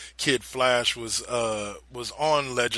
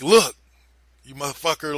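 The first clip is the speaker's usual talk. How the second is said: mock angry outburst; 6 dB louder than usual. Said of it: "storms in, like".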